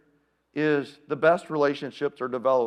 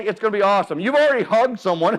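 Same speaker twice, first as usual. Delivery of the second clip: ordinary delivery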